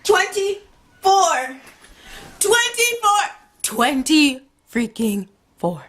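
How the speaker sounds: high, slow stunned repeat; can't get over the number; shocked disbelief. Real, not acted. Reacting to his age.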